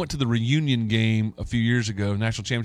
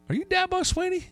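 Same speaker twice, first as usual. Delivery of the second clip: retold cop's question, pitched way up in mock surprise; surprised policeman; 4 dB quieter than usual. Policeman at the barricade asks the coach, in high mock surprise. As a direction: play the startled officer who suddenly recognizes him.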